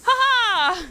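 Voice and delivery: high, loud "ha ha" to cap the pitch; one big put-on laugh; loud hammed-up laugh; mock triumphant laugh. Caps her mock advert.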